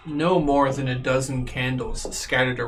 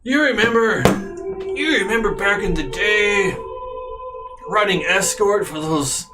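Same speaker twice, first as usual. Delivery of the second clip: high, eager welcoming host voice; 5 semitones higher than usual. Acted. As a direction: greet weary guests with delight. GM voices Ayla reminiscing.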